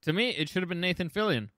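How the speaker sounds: ordinary talk, nothing marked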